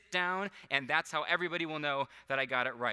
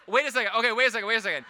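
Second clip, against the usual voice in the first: higher and louder, hurried repeats; urgent wait a second; 7 semitones higher, 10 dB louder, faster than usual. Real, not acted. Host to audience, urgently halting after a wrong guess. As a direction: stop everything, something's off.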